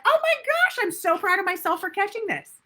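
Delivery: high, gushing proud self-talk; excited self-praise. Shown as a demonstration. Coach models delighted self-praise instead of beating yourself up, high and gushing. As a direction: celebrate catching yourself. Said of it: "be like".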